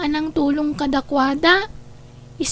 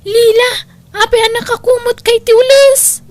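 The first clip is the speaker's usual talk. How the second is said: alarmed; 6 semitones higher, 7 dB louder than usual. Acted. Alarmed cry over a missing sibling.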